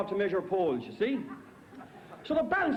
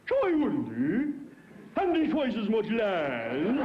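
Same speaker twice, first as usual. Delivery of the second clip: slow drawn-out barman; 2 semitones higher, much slower than usual. Acted.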